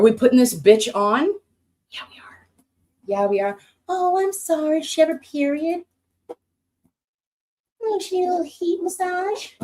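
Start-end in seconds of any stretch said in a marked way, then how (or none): 1.9-2.5 soft unvoiced whisper. Real, not acted. Answering herself about using the massager, whispered. 3.9-9.5 much higher, cooing mock-sympathetic voice; mock baby talk. Teasing herself about needing heat, in a high cooing voice.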